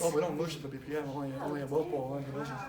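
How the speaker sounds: low, soft mumbled objection; mimicked reluctant host. Mimicking hosts who don't do the whoosh, low and subdued. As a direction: imitate a subdued host making excuses, low.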